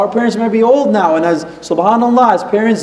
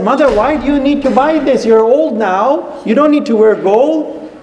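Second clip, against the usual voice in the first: raised, higher-pitched scolding; upset son; 5 semitones higher than usual. Retold or imitated. Son scolding his mother in the gold shop, upset. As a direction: retell an upset son's protest, pitched up. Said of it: "he got so upset".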